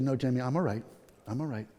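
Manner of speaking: low, soft brush-off; subdued retold reply, dropping away; muted reassurance. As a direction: reassure quietly, fading on the repeat. His muted reassurance to nurse.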